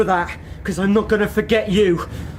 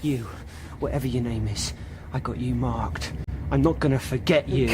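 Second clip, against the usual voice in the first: low menace; 11 semitones lower, 7 dB quieter than usual. Acted. Drunk threatens the passenger.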